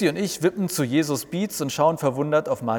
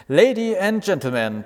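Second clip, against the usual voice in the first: stage announcer; 7 semitones higher, 5 dB louder than usual. Acted. Jesus announces the show.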